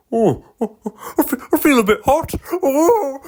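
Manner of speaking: very high, strained car voice; a car moaning that it feels hot; overheated car. Acted. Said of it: "The driver was concerned".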